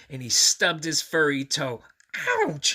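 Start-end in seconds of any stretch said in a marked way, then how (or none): 2.2-2.8 a much higher, louder pained yelp; Grover yelp. Acted. Grover stubbing his furry toe, yelping. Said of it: "he said".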